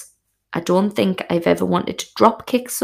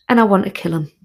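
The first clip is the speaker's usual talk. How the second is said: ordinary delivery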